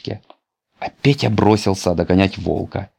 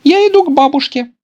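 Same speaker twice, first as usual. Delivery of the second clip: put-on very high, light girlish voice; a small girl chirping her answer; little girl voice; 6 dB louder, faster than usual. A narrator's reading. (девочка отвечает волку, высоким детским голоском)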